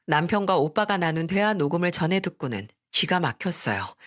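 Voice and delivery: plain reading voice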